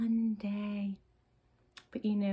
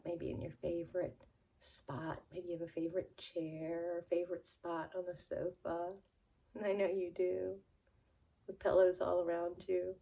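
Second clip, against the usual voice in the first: ordinary delivery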